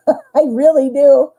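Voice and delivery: laughing through the words. Real, not acted. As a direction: chuckle as you say it. Laughing while apologizing.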